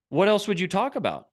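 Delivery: ordinary delivery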